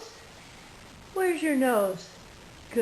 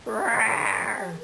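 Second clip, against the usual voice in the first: lion roar. Pretend. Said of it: "what the lion says".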